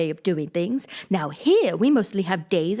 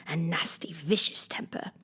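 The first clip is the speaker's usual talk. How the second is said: hushed aside; 3 semitones lower, 7 dB quieter than usual. Acted. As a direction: breathe it out like a secret. Red Queen's hushed remark.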